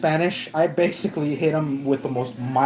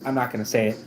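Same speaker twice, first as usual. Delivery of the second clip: ordinary talk, nothing marked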